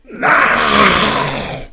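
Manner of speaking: voiceless forced whisper-shout; hissed whispered no. Acted. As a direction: shout it in a hiss under your breath. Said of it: "I whisper shouted?".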